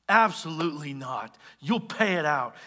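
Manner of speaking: a firm, measured no; firm refusal. Acted. Servant refusing his debtor mercy, firm refusal. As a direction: put your foot down. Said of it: "puts his foot down".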